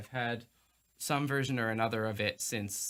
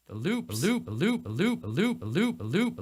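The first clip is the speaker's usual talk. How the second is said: repeating pitched-up looped phrase; 6 semitones higher, 4 dB louder than usual. Shown as a demonstration. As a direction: loop the words, rising each time. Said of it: "so that I can do that".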